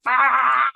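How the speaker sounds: loud, high cat yowl; imitated cat yowl. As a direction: yowl back like an annoyed old cat. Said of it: "she would look at me, she would go".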